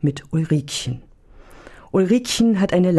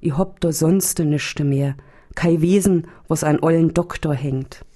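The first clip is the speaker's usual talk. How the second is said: plain reading voice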